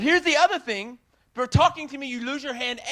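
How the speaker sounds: mock furious warning; imitated angry yell. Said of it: "she's yelling, screaming".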